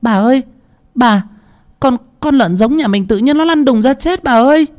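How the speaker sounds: put-on high-pitched frantic servant girl; stumbling, panicky high cry for the mistress; panicked. A narrator's reading. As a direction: burst out with alarming news, stammering. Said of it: "miệng hốt hoảng".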